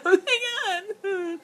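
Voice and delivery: high, drawn-out fake wail; mock crying. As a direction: pretend to sob, stretching it out. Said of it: "not from tears like".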